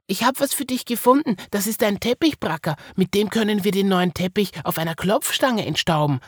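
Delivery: put-on high, proud child voice; proud Marie. A narrator's reading. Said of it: "sagte Marie stolz".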